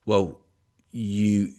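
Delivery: ordinary delivery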